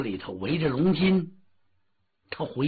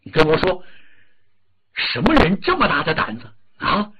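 furious demand; 2 semitones higher, 5 dB louder than usual. Acted. The grieving Dragon King demands who killed his son, furious.

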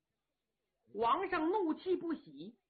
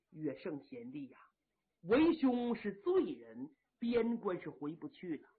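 put-on steady old marshal; 2 semitones lower than usual. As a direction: speak as the grave elder brother calming his men. Yang Liulang voiced in story.

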